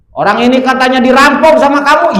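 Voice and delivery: high, loud demanding question; angry questioning. Acted. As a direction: press him hard, angrily. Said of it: "Marah Kangjeng Nabi". (bertanya dengan marah dan keras)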